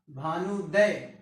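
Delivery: slow demo. Shown as a demonstration. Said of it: "ये दीर्घ बन जाएगा".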